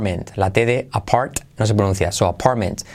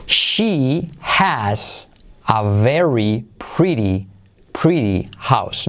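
very slow, careful reading; slow model sentence; 2 semitones lower, much slower than usual. Shown as a demonstration. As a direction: stretch it out for learners. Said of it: "Mira la transcripción". (frase modelo muy lenta)

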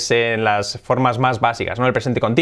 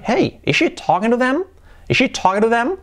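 much higher, "them" swept upward; question intonation; 9 semitones higher than usual. Shown as a demonstration. Teacher demonstrating intonation to learners, rising question on "them". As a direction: pitch it high and lift "them" as a question. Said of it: "Entonación".

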